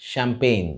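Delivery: CHAMPAGNE said as "sham-pain"; SH sound, silent G. Shown as a demonstration. Teacher shows how champagne is said, SH sound. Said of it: "and pronounced as".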